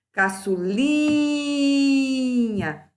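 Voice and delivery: "Caçulinha" with a long, high "li"; stressed syllable drawn out. Shown as a demonstration. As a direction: hold the "li" of "Caçulinha" high, like calling it out. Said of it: "Sílaba tônica penúltima".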